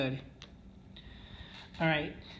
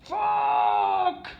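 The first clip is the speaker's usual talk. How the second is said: sudden high yell of frustration; a gambler's furious shout at losing it all; retold scream; 6 dB louder than usual. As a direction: blurt it out as a loud, high cry. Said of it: "he screamed".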